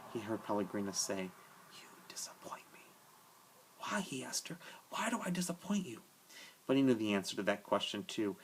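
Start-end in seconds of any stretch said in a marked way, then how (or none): 1.7-2.8 breathless, toneless whisper; a faint whispered rebuke from memory; ghostly whisper. A narrator's reading. Pellegrina's remembered voice in the dark. 3.8-4.0 whisper. A narrator's reading. Edward answers the voice in whisper. 4.9-6.0 pleading, toneless whisper, a little higher; whispered plea. A narrator's reading. Edward whispers back to Pellegrina.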